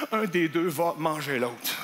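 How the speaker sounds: slow, low, ominous; ominous narration. Acted. Comic telling himself the burger showdown, slow and ominous. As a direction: announce the duel like a western.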